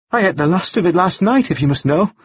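high-voiced, defiant Anna figure in an argument; defiant woman character. A narrator's reading. Woman insisting to her questioner that she ate it, defiant.

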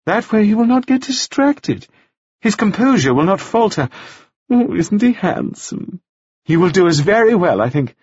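chattering widow, pleased with herself; gushing widow. A narrator's reading. Mrs Wells gushes.

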